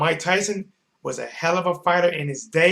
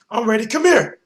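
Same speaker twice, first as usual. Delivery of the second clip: taunting shout; 5 semitones higher, 7 dB louder than usual. Retold or imitated. Retold taunt.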